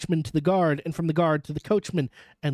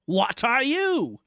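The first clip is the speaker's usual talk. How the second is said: slow, raised challenge; guard's retort; 6 semitones higher, much slower than usual. A narrator's reading. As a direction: demand who goes there. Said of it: "the guard retorted".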